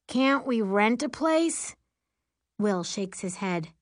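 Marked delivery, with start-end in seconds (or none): 0.1-1.8 high, slow, whiny plea; drawn-out teenage complaint; groaning complaint. A narrator's reading. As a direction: groan the question out, pitched up. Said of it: "River groans".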